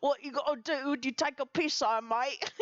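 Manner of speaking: bad British impression. Mocking a friend's voice, bad British accent. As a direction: do a deliberately awful Cockney, giggling. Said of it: "really shitty impressions of Dorian".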